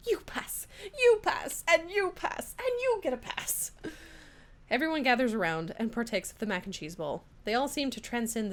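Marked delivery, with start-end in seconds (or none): 0.1-3.7 much higher, jubilant character voice; exuberant announcing. Acted. Judge passing every student, high and exuberant. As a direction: hand out each one like a prize. Judge hands out passes, building.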